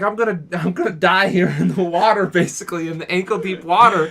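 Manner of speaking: hammed-up crybaby wail about dying; mock crying. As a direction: imitate him near tears, whiny. Crybaby friend freaking out, retold.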